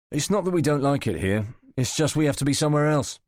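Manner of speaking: a hint of a smooth, dismissive pro; Sinclair, brushing off waiter. Sinclair politely fobbing off the waiter, lightly voiced and dismissive.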